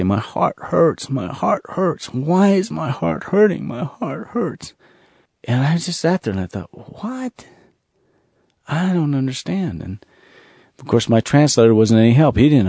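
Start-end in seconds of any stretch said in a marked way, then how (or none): 0.0-4.7 soft, slow, pained voice of a grieving villager; aching heart. Retold or imitated. Villagers' grief retold by pastor. 7.0-9.8 a sudden high, breathy "What?"; baffled. Real, not acted. Speaker baffled by the answer.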